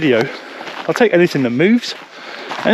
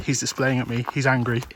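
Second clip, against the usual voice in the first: much softer, almost whispered, urgent; hushed and tense; 4 semitones lower, 12 dB quieter than usual. Real, not acted. Backing away from an angry capercaillie: tense, much softer hushed voice. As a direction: keep it barely audible so the bird stays calm.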